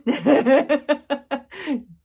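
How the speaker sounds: high, loud falling laugh. Real, not acted. Laughing to viewers at the husband's fate, high and loud. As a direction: let a big laugh fall away.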